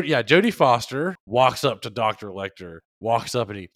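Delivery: normal talking voice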